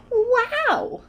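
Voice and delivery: high, loud wow; high-pitched childish wonder; amazed. Acted. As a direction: marvel out loud. Tina amazed again.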